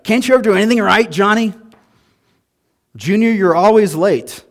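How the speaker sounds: put-on high, loud, fast nagging at the kid; scolding parent. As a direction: rattle off blame like an exasperated parent. Acting an exaggerating, scolding parent.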